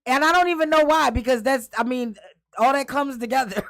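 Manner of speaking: high, loud, worked-up storytelling; loud excited. Real, not acted. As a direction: tell it all fired up and loud. Loud excited retelling of ride.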